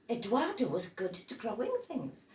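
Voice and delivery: plain reading voice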